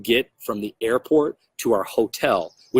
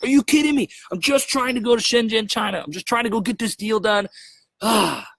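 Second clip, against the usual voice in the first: exasperated outburst; 10 semitones higher than usual. Retold or imitated. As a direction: blow up at the hold-up, pitch soaring. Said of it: "I just wanted to get pissed off".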